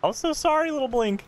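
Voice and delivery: high, half-amused lament; high-pitched pity. Real, not acted. Player apologizing to little Blink, high-pitched mock lament.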